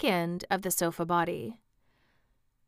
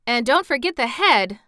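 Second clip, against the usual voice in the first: high, loud exclamation; exclaimed reminder; 5 semitones higher, 8 dB louder than usual. A narrator's reading. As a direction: pipe up insistently, louder and higher. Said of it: "exclaimed the Wogglebug".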